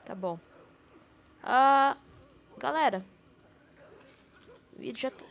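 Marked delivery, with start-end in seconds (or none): 1.4-3.0 high, loud startled exclamation; startled loud cry. Real, not acted. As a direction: blurt it out in alarm. Shock after scare.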